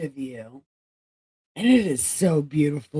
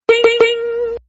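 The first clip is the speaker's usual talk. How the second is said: game show ding; 10 dB louder than usual. Pretend. Game show bell imitation.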